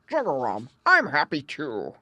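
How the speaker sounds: loud, croaking frog voice; gruff old frog. A narrator's reading. Grandfather Frog agreeing with the singing bird, croaking.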